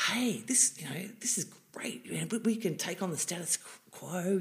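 hushed, secretive thrill; whispered thought. Retold or imitated. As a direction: whisper it like a conspiracy. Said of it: "And I thought".

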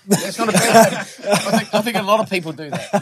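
high, giggling through it; laughing. Real, not acted. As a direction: laugh while saying it. Giggling over his remark.

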